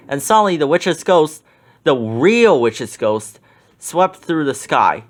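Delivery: plain reading voice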